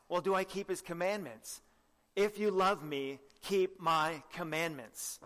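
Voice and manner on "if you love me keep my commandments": slow solemn quote. Acted. Quoting Jesus slowly for emphasis.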